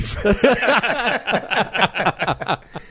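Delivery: long, high, loud laugh; real laughter. Real, not acted. Co-host cracking up at witch capitalism joke, high laughter.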